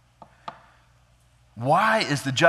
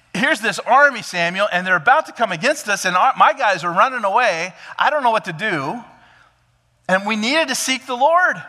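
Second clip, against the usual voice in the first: much higher, louder panicked pleading; frantic excuse; 8 semitones higher, 6 dB louder than usual. Retold or imitated. Saul panicking to Samuel about the fleeing army. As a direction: sound desperate and overwhelmed.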